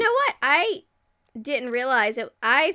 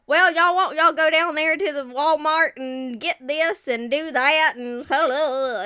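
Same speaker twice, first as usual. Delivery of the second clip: loud, raised mock-Southern chatter; country accent; 4 semitones higher, 6 dB louder than usual. Mocking locals headed to Walmart, loud country accent. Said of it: "more abrasive with the country accent".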